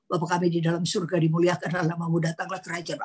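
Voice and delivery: fast mock recitation of the Lord's Prayer; hurried, lightly mocking prayer; rattled-off prayer. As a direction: gabble the prayer like a rote formula. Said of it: "Dia nggak doa".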